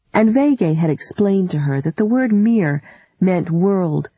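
ordinary delivery

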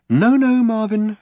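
high, sing-song reassurance; lilted. A narrator's reading. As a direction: soothe him in a lilting voice. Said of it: "lilted Trillian".

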